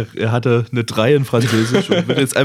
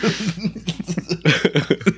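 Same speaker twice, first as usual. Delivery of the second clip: high giggle; 8 semitones higher, 5 dB quieter than usual. Real, not acted. Laughing after the bit.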